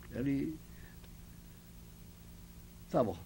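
ordinary talk, nothing marked

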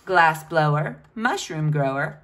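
ordinary delivery, nothing marked

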